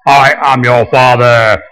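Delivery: slow Vader voice through echo mic; Darth Vader. Retold or imitated. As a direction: intone it slowly like a movie villain. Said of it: "however he speaks".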